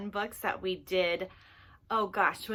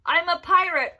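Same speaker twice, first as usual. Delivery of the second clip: usual speaking voice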